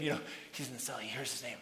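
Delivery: ordinary delivery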